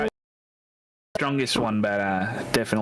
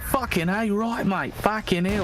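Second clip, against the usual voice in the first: Aussie accent pitched way up and twangy; high twangy Aussie; 9 semitones higher, slower than usual. Shown as a demonstration. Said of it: "Like that".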